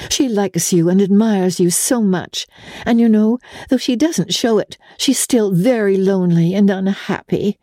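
a hint of a young fiancée, earnest and confiding; lightly voiced young woman. A narrator's reading. May writes to her fiancé about Ellen, lightly voiced and sincere. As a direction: read the letter as a gentle, candid girl. Narrator voices May's letter.

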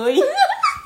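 sudden high laugh that rises at the end; a bright laugh over the silly spelling; high squeal of laughter. Real, not acted.

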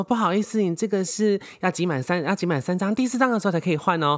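high, syrupy customer-service tone; overly sweet polite cashier; sickly polite service voice. Retold or imitated. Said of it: "你知道我跟客人讲话有多恶心".

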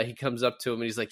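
normal talking voice

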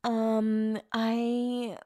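slow, hesitant mock apology voice; mocking Rachel. Vlogger roasting a contestant's apology, slow halting mockery. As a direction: drag it out like a halting, rehearsed apology. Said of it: "I was kinda roasting her in her apology".

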